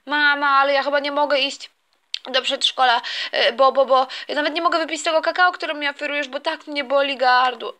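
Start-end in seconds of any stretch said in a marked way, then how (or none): none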